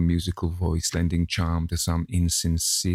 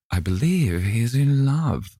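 high, louder teasing cry; cried out; 6 semitones higher, 4 dB louder than usual. A narrator's reading. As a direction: cry it out playfully to the table. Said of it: "cried Lady Narborough".

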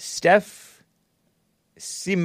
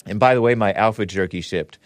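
normal talking voice

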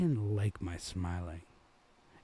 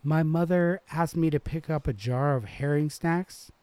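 put-on high, prim girl asking politely; a prim rich girl, pitched up high; startled girl's voice; 8 semitones higher, 7 dB louder than usual. A narrator's reading. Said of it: "Her voice kind of startled me".

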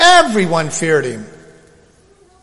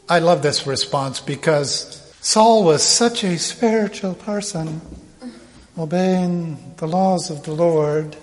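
usual speaking voice

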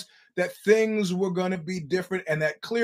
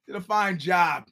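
ordinary talk

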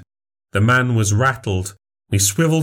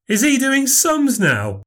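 much higher jeering voice; mocking heckle. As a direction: jeer across the room. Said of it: "Shouted one of the rugby players".

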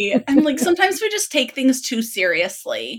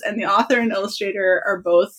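normal talking voice

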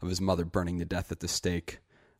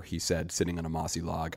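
ordinary delivery

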